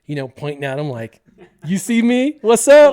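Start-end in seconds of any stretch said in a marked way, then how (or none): 1.6-2.9 high, loud boastful call; cocky showboating. Acted. Airborne kid shows off to older boys, loud cocky shout. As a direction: crow it out to the onlookers. Showing off in mid-air.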